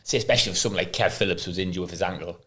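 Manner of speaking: ordinary talk, nothing marked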